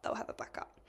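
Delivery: whispered to herself; a reassuring whisper to herself. Retold or imitated. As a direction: breathe the words under your breath. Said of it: "And I'm like".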